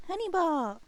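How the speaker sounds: calling out. Real, not acted. Calling out to partner.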